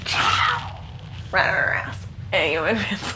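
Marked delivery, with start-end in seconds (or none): none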